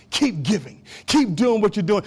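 ordinary talk, nothing marked

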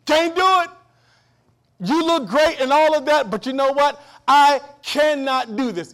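Can't do it said loud and high; emphatic refusal. Retold or imitated. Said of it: "And he says".

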